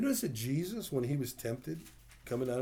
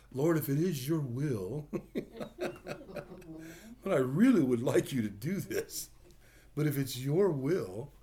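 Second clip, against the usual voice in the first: laughing; 4 semitones higher, 4 dB louder than usual. Retold or imitated.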